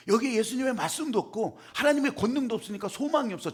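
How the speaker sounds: normal talking voice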